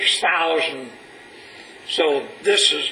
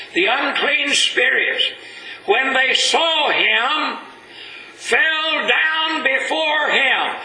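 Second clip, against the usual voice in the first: high and slow, swelling narration; slow right down and lift it as they fall; dramatic scripture reading; 7 semitones higher, slower than usual. Acted. Preacher reading Mark to his congregation, high and drawn out.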